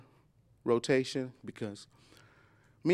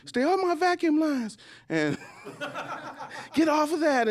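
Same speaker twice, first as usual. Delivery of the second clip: bossy wife; 12 semitones higher than usual. Retold or imitated. Wife's orders quoted.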